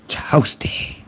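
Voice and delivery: breathy whisper of the catchphrase; whispered toasty. Retold or imitated. Teasing the rival, a whispered catchphrase.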